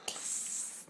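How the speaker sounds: soft unvoiced hiss like a snake. Shown as a demonstration. Mom showing her toddler a snake's hiss.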